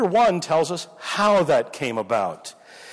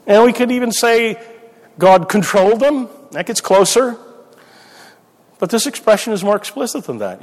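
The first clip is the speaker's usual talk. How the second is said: emphatic; 6 semitones higher, 7 dB louder than usual. Real, not acted. Building an emphatic point.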